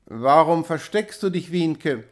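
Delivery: plain reading voice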